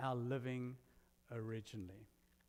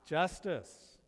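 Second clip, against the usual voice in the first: triumphant; 4 semitones higher, 6 dB louder than usual. Retold or imitated. Triumphant retold reaction in court.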